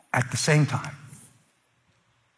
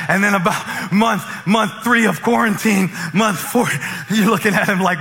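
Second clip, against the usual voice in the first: loud, higher, punchy sermon delivery; full-force preaching; 8 semitones higher, 6 dB louder than usual. Real, not acted. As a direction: belt it out to the congregation.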